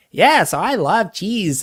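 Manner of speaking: lightly put-on Wisconsin accent, "love" drawn out; mock Wisconsin accent. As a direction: gush about cheese in a hint of a Wisconsin accent. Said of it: "the Wisconsin accent".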